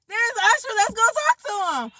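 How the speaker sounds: high-pitched reenacted fangirl squeal; acted-out giddy excitement, way up high; excited star sighting. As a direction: squeal it like you just spotted a celebrity. Acting out spotting a star.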